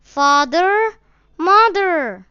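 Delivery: put-on high, loud frightened child's call; lost boy calling loudly for his parents; crying aloud. A narrator's reading. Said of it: "calling out".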